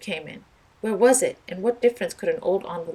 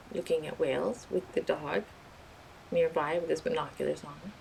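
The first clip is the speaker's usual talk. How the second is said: ordinary delivery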